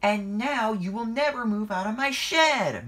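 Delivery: high, loud moan. A narrator's reading. Emily complaining about her shed, moaning loudly. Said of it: "moaned Emily".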